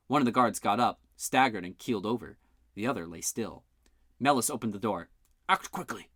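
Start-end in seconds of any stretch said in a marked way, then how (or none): none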